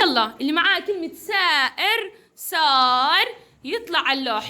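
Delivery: ordinary delivery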